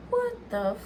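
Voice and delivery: very high, slow, stunned what the fuck; shocked disbelief. Retold or imitated. Staring at the positive test, shocked and high. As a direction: gasp it out in total disbelief. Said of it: "I was looking at 'em like".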